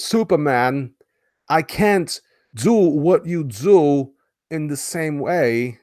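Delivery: Walken's Bizarro addressing Superman. Pretend. Walken's Bizarro speaks to Superman, slow and halting. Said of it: "He says".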